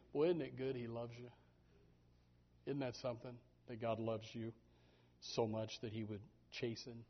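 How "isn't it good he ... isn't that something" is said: ordinary delivery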